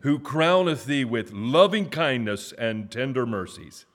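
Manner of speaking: high, loud proclaiming of scripture; preaching. Acted. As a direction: call out each verse for the church to echo. Leads responsive scripture reading.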